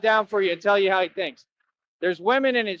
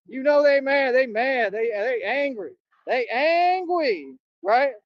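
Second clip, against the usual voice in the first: mocking taunt; 6 semitones higher, slower than usual. Real, not acted. Taunting haters as mad.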